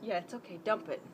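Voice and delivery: usual speaking voice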